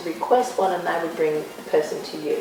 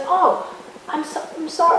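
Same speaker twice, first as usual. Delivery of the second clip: light mimicry, pitched high; David Beckham; 9 semitones higher than usual. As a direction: raise the voice right up for his apology. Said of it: "I, I won't do his accent".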